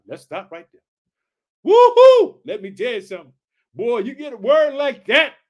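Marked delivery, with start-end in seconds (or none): 1.6-2.4 a high, loud joyful whoop; excited whoop. Real, not acted. Preacher cheering after the verse, loud excited whoop. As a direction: whoop out with joy.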